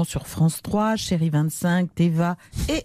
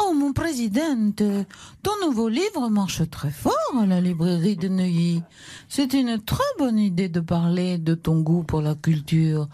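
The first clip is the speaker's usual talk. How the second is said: [name]. Acted. Wife praises her president husband's new book, languid gushing voice. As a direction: gush languidly at your husband. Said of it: "[name]".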